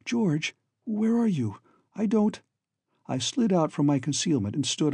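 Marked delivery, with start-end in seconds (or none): 0.0-2.4 higher, a touch breathless and alarmed; a hint of a frightened girl searching the dark; startled young woman. A narrator's reading. Babs calling to hidden George, mildly startled. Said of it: "She gave a little cry".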